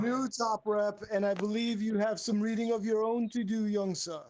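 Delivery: grand pompous master. Acted. Host sending the winner off to read, mock-grand. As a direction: address a pupil with mock ceremony. Host closes the award in character.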